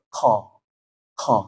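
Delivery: "Kha" as a k with a puff of air; qaf with breath. Shown as a demonstration. Teacher showing the forbidden breathy qaf, aspirated. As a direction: leak air after the stop in "Kha". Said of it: "Nggak boleh".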